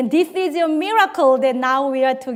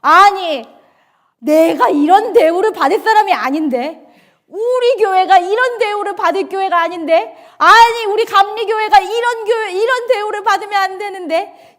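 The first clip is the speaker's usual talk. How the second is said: higher, louder offended complaint; proud, indignant voice; 5 semitones higher, 5 dB louder than usual. Retold or imitated. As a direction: act out wounded pride, voice raised. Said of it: "그러니까 이런 거예요". (높고 큰 억울한 목소리)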